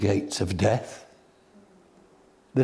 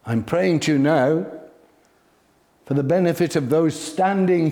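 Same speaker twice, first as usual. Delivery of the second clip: higher, louder, earnest prayer to heaven; voicing Jesus' prayer; 6 semitones higher, 5 dB louder than usual. Jesus praying before the crowd at the tomb, raised and earnest. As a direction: lift the prayer up for all to hear.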